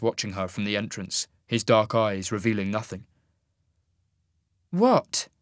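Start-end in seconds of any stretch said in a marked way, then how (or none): 4.7-5.3 sharp, high-pitched challenge; demanding. A narrator's reading. Sasha challenging the man watching her, demanding. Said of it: "she demanded".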